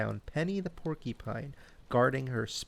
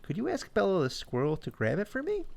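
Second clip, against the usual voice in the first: high chipmunk voice; 7 semitones higher, faster than usual. A narrator's reading. Chip voiced in story.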